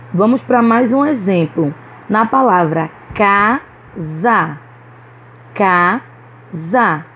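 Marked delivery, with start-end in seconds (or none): 3.1-4.6 "casa" split in two, strong ca; stress on first syllable. Shown as a demonstration. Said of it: "K foi pronunciada mais fortemente". 5.5-7.1 "casa" split in two, strong ca; stress on first syllable. Shown as a demonstration. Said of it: "K foi pronunciada mais fortemente".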